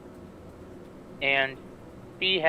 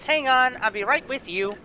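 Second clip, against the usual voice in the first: higher and louder, calling from behind the counter; unseen voice calling; 10 semitones higher, 6 dB louder than usual. Acted. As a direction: call out from out of sight.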